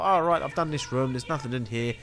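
ordinary delivery

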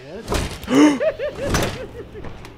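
high startled intake of breath; sharp gasp. Real, not acted. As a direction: gasp in fright. Startled in horror game.

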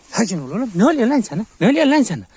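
high shout rising in pitch and force; escalating shouted reprimand; loud scolding. Real, not acted. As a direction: demand to know what got into the dog. Calming and scolding riled dog.

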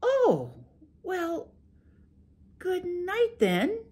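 high sweet wife making excuses. Acted. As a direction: play innocent to the ogre, high and gentle. Wife says good night.

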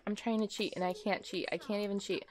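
ordinary talk

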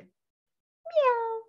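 high-pitched meow; cat meow imitation. A narrator's reading. Family cat greeting Benny, high meow. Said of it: "said his cat".

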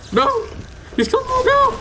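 real high-pitched shriek of fear; panicked scream. Real, not acted. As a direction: scream as the enemy closes in. Enemy rushing, out of bullets.

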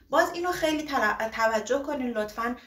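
normal talking voice

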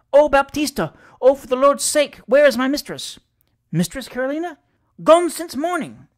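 loud, high, panicked calls; a distraught maid raving in alarm; frantic crying out. A narrator's reading. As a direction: maid cries out to courier in panic. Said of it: "so cried and raved".

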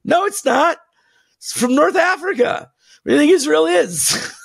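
high-pitched, exasperated rebuttal; letting it all out. Real, not acted. Host rebutting a claim about Christianity's origins, letting it all out. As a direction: pitch jumps up in an indignant outburst. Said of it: "I'm just gonna let it all out now".

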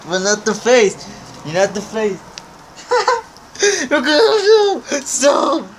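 giggling squeal while tickled; laughing helplessly as the dog tickles; being tickled. Real, not acted. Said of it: "you're tickling me".

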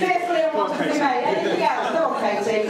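loud and fast; rousing call. Real, not acted. Preacher rouses the congregation with a loud call. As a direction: fire up the crowd.

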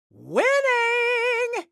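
high, sung-out victory crow; gleeful gloating. Acted. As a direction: crow it high, stretching the word. Game hero celebrates winning.

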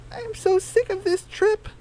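squeaky-high storybook hen asking the group to split up; high Daisy voice. Acted.

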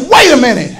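righteous preacher's challenge, building to full force; forceful, driving outrage from the pulpit; stirred, convicted indignation. Acted. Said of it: "we are stirred and we are convicted".

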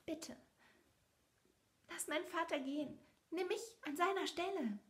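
high, earnest plea; brave plea. A narrator's reading. Belle offers herself in place of her father, high and brave. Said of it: "sagte sie tapfer".

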